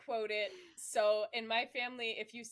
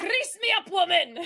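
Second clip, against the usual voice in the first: high, loud Scottish shout; Groundskeeper Willie; 9 semitones higher, 15 dB louder than usual. Retold or imitated. Fan doing Willie's line to friends in a high, loud Scottish shout. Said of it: "do you like Groundskeeper Willie".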